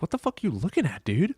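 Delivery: high, startled question to the dog; half-spooked, asking the dog what it sees. Retold or imitated. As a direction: owner startled by his staring dog. Retold talking to his dog.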